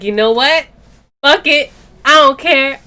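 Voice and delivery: loud, high blow-up of I-don't-care; fed-up outburst. Retold or imitated. To the boyfriend who insulted her, a loud fed-up outburst. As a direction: snap and let it all out loud.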